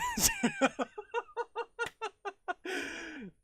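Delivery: high laugh; high burst of laughter. Real, not acted. Streamer cracking up over the long word, high laugh.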